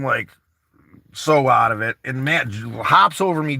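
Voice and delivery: ordinary talk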